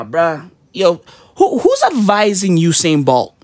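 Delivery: ordinary talk, nothing marked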